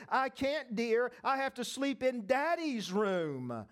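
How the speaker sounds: ordinary talk, nothing marked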